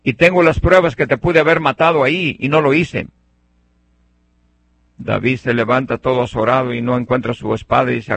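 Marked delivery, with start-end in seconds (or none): 0.0-3.1 high, raised call to someone far off. Retold or imitated. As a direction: shout it across to a king. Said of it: "le grita, le dice".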